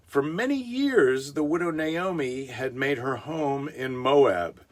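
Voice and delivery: storybook narrator voice, lifted; story narration. Acted. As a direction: read it like a children's Bible story. Storybook Bible reading.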